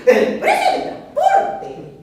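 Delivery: high, loud, slow shuddering moan; "El precio del transporte" wailed high and slow in horror. Acted. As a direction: shiver as you say the price. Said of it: "con escalofrío".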